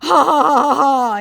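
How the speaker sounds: high, loud taunting ha-ha; a smug fake laugh mocking the victim; mocking scammer laugh. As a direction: cackle like you've just scored a point.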